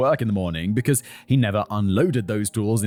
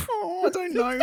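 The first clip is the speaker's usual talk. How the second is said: much higher, whimpering "Oh, I don't know"; mock whimper; 3 dB quieter than usual. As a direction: squeak it out like you're about to cry, half laughing. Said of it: "And I'm like".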